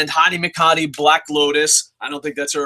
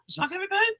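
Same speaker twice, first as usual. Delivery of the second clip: surprise; 9 dB quieter, much faster than usual. Retold or imitated. Quoting own surprised reaction.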